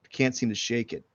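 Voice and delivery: ordinary talk, nothing marked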